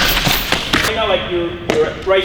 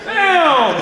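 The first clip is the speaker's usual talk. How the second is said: high, loud disbelief at the throw; hyped surprise; amazed exclamation; 8 semitones higher, 3 dB louder than usual. Real, not acted. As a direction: shout it out, impressed. Reacting to a big throw.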